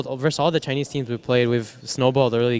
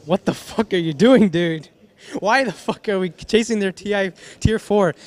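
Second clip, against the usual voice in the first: mock outrage, pitched up high; exasperated, higher-pitched complaint; retold exasperated scolding; 6 semitones higher than usual. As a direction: imitate chewing out a reckless teammate.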